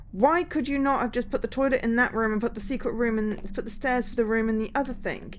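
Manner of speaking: loud, incredulous complaint; exasperated rant. Real, not acted. Streamer ranting at absurd room layout.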